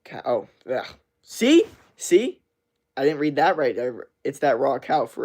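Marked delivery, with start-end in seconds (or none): 1.2-2.4 high, loud triumphant see; vindicated. Real, not acted. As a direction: crow that he was right. Proving he misreads.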